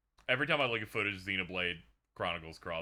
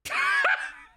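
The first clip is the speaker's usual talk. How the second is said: high, loud shrieking laugh; 7 dB louder than usual. Real, not acted. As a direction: burst out in a squealing laugh. Huge burst of laughter.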